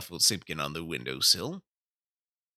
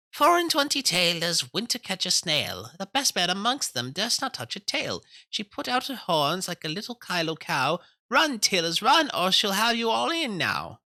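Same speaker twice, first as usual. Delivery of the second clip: high, loud sing-song mouse voice; 11 semitones higher, 4 dB louder than usual. A narrator's reading. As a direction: chant it brightly like tiny mice. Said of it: "little mouse voices sang loudly and gaily".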